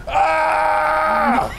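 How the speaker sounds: a sudden high, loud yell, acted out; retold startled cry, hammed up; imitated startled yell. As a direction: mimic the man bursting in with a yell. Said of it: "and he just goes".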